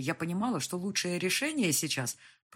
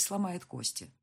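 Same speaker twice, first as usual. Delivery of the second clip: ordinary delivery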